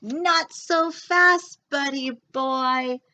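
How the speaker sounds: slow drawn-out principal warning; stern principal. A narrator's reading. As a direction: stop the dog in its tracks. Principal catches dog.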